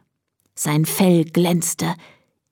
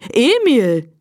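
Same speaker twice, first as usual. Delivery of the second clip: higher, surprised name call; 4 semitones higher than usual. A narrator's reading. Farmer's wife finds the donkey at her door, calling out. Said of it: "rief sie".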